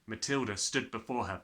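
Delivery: usual speaking voice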